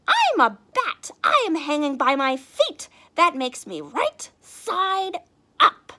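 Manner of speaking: very high, loud squeaky bat; know-it-all bat. Acted. The bat explains to Stellaluna, high and loud. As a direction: explain it like a smug little bat.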